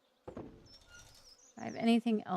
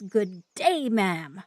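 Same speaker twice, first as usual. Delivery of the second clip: curt dismissal; 2 semitones lower, 11 dB louder, much slower than usual. Acted.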